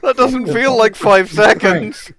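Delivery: loud amused complaint. Real, not acted. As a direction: protest the noise while laughing. Reacting to loud clip.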